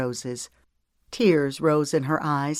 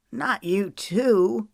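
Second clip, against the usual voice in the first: mild mock bellow; 6 semitones higher, much slower than usual. A narrator's reading. Brother catching sniggering woman in a hug, mock bellow. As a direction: groan it out slowly in mock dismay. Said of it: "he bellowed".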